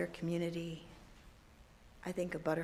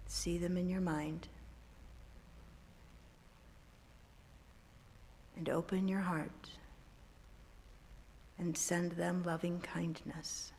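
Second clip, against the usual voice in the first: guided meditation; 7 dB quieter than usual. Acted. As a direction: guide them gently into calm. Leads a meditation.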